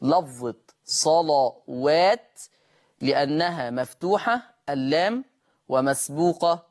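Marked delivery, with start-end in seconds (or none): 0.0-2.4 صلوات slowly with a thickened lam; heavy lam. Shown as a demonstration. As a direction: give صلوات a dark lam. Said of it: "سبب تغليظ".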